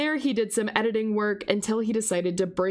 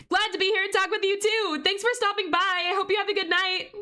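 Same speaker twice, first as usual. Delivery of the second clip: much higher, sing-out goodbye to a viewer; high-pitched farewell; 11 semitones higher than usual. Real, not acted. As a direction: send them off in a high, chirpy voice. Streamer answers a departing viewer.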